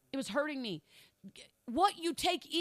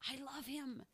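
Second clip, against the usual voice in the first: hushed echo; 12 dB quieter than usual. Acted. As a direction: drop to almost nothing on the repeat. Repeated line, whispered echo.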